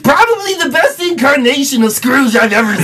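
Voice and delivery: Bobcat voice. Retold or imitated. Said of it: "I agree with Bobcat".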